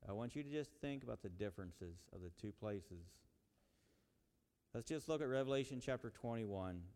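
usual speaking voice

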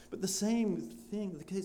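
usual speaking voice

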